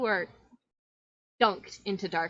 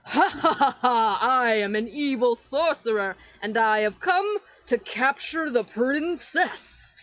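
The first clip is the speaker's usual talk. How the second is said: louder, hammy villain cackle and boast; evil villain laugh; 3 semitones higher, 6 dB louder than usual. Acted. Shadow villain threatening to capture the princess, hammy boast. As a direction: ham it up like a cartoon sorcerer. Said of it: "basic villain evil laugh".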